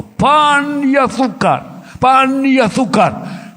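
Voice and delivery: slow, high, loud wailing cry; screaming child. Retold or imitated. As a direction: wail it like a starving child. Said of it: "gritando y llorando".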